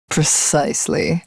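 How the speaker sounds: normal talking voice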